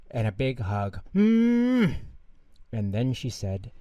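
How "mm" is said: high, loud smacking kiss noise; kiss sound. Acted. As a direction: plant a big kiss. Taya kisses her father.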